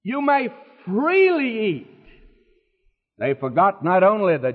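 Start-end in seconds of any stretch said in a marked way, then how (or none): 0.0-1.9 FREELY stretched out high; high declaration swelling on "freely"; God's generous proclamation. Retold or imitated. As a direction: lift "freely" high and hold it. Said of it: "And then God says".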